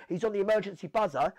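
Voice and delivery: ordinary talk, nothing marked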